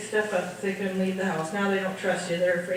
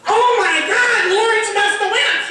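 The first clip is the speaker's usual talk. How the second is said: much higher, loud, laughing alarm; alarmed nurses; 10 semitones higher, 8 dB louder than usual. Retold or imitated. Nurses realise what the page means, loud high alarm. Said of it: "The nurses around the break going".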